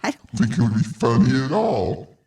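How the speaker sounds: low electronic disguise filter; disguised deep voice. Real, not acted. Voice effect button pushed.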